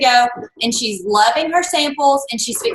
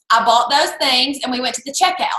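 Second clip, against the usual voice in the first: normal talking voice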